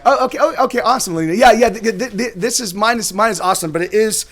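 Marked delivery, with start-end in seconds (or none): none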